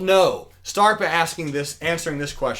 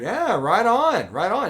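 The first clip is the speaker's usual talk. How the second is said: drawn-out, higher-pitched approving reply; acted casual approval; 6 semitones higher, slower than usual. Businessman humouring the fisherman, acted casual approval. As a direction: stretch it out as an agreeable onlooker.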